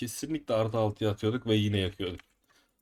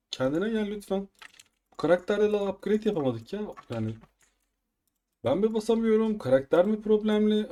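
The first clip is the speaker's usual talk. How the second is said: exasperated scolding; 9 semitones higher than usual. Real, not acted. Scolding his game character.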